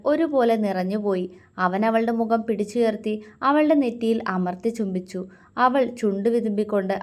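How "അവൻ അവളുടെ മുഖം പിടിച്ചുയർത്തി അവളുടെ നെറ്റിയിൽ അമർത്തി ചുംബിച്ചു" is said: ordinary delivery, nothing marked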